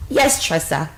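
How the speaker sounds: put-on British accent; British accent played for fun. Shown as a demonstration. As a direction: answer primly in a mock British voice. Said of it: "This is the accent".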